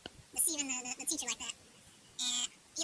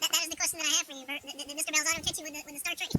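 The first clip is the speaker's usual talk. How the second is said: ordinary talk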